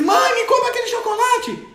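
put-on high, loud child begging; whiny child shout. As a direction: beg loudly for the chocolate like a kid. (criança pedindo alto e agudo)

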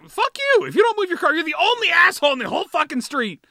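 high, loud shouted rant; furious rant. Retold or imitated. Ranting at the neighbor who didn't move her car, loud angry shout. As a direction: yell it at the neighbor.

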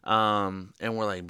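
usual speaking voice

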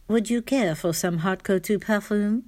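imitated French sales pitch; French accent. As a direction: ask it in a playful mock French accent. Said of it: "Mary would use a French accent".

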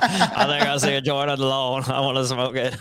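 not-very-good presidential impression with a laugh; Bill Clinton. Host as president eyeing a joint on the lawn, as Bill Clinton. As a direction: do a comic, amateur ex-president voice. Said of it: "I have one impression. It's not even a good one of Bill Clinton".

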